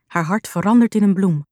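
ordinary delivery, nothing marked

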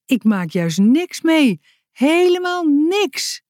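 slow, rising exasperated complaint; emphatic protest. A narrator's reading. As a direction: stretch it out high in protest. Envious friend protesting her dull life. (nadrukkelijk klagend)

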